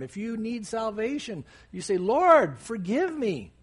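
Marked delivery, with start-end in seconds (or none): 2.0-3.5 loud, higher cry on "Lord"; acted plea, raised and pitched up; calling out. Sinner asking the Lord for salvation, calling out. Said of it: "You call out to him".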